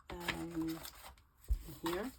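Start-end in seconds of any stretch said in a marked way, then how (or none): none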